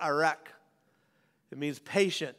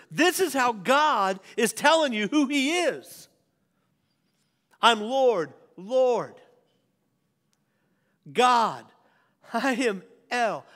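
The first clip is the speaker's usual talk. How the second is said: raised, ringing preaching voice; 5 semitones higher, 4 dB louder than usual. Real, not acted. Pastor proclaiming God's names to the church. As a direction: proclaim it with full force.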